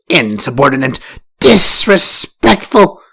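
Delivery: first wife spits insults at Farah; spat out. A narrator's reading. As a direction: spit each word out in contempt. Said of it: "The first wife spat".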